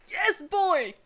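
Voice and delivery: high, loud cheer of delight; excited cheer. Real, not acted. Vlogger cheering Tanjiro's best line, loud high excited. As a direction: cheer like your favourite just scored.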